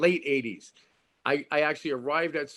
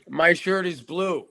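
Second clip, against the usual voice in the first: louder, drawn-out insistence; insistent; 3 semitones higher, 6 dB louder, slower than usual. Acted. Actor insists to partner in repetition exercise, insistent. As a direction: dig in, slow and forceful. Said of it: "why are you being so angry".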